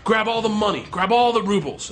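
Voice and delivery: high-pitched reenacted command; escalating demand, acted out; urgent order. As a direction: press him to grab the loot. Igor ordering him to rob.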